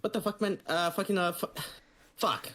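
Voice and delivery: high mock Micronesian accent sputtering curses; imitating Micronesian accent. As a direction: do the sergeant's accent, frustrated and garbled. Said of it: "that's how he talks".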